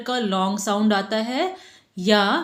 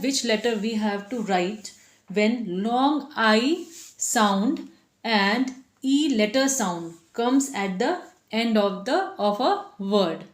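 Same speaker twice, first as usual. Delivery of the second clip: slow quoted question; much slower than usual. Retold or imitated. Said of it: "बच्चों का क्या question रहता है".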